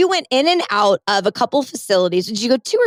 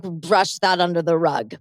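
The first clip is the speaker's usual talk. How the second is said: ordinary talk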